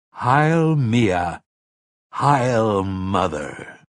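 slow, ceremonial proclamation; solemn, drawn-out acclaim; leading a salute. A narrator's reading. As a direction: lead the hail, stretching the words. Sayre leads the crowd's salute.

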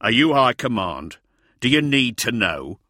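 loud, scornful questions; contemptuous soldier's put-down; sneering. A narrator's reading. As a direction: sneer at a nosy civilian, forcefully. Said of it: "sneered one of the hussars".

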